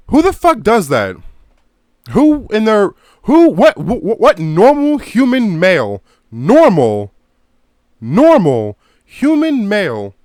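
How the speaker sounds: loud, high-pitched incredulous rant; outraged disbelief. Real, not acted. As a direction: sputter and cry out in disbelief. Outraged rant about a movie scene.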